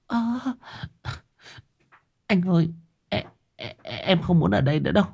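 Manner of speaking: high, soft, stammering wife; upset wife. A narrator's reading. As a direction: plead uneasily in a high, small voice. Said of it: "Liễu khó chịu". (giọng vợ cao, khẽ, khó chịu van nài)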